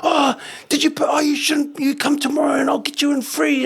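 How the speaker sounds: put-on an old man thrilled to meet a star; squealing, overjoyed shout of recognition; excited old fella. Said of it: "he goes".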